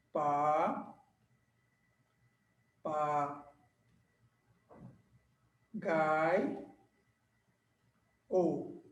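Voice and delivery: slow, soft, split into syllables; pa pa gai o, one beat each. Shown as a demonstration. Teacher splitting papagaio for the class. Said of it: "Vamos separar".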